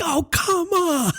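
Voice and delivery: high, drawn-out exasperated cry; frustrated yell. Retold or imitated. Dad crying out to the car full of kids, exasperated. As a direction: wail it like a dad at the end of his rope. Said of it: "when I yelled that".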